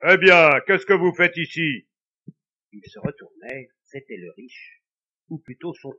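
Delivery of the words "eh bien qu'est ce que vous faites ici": loud, rough voice of the ghost. A narrator's reading. Ghost confronting the couple at midnight, loud and rough. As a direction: bark it out as the angry dead brother. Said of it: "une voix rude".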